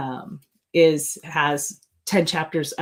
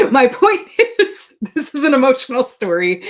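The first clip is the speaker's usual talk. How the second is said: laughing; 8 semitones higher, 9 dB louder than usual. Real, not acted. Author to viewers, laughing after a serious tangent. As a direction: shake off the heavy moment with a laugh.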